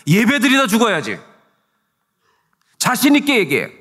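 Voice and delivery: high, loud imitation of a scolding; shouted rebuke. Preacher voices the Lord challenging the pastor, shouted rebuke. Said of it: "이렇게 소리치더래요".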